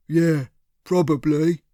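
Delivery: slow-talking thug, a lightly put-on voice; a hint of a dim heavy; henchman Cyril. A narrator's reading. Cyril answers his boss, a lightly voiced dim thug.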